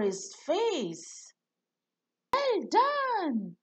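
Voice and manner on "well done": high, bright call of praise; a high cheer of praise; cheerful shout. A narrator's reading. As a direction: cheer him on. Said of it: "shouted Belle".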